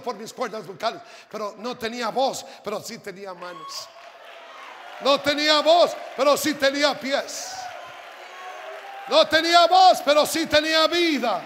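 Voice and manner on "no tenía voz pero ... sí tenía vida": raised, shouted preaching in rising waves; full-force preaching. Real, not acted. Preacher testifying to the congregation about losing his voice, shouted preaching. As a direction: proclaim each phrase to the congregation, loud.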